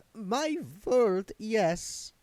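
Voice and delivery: high, slow, affected countess voice; a lofty vampire countess, drawn out; Doreen's voice. A narrator's reading. Doreen's character voice.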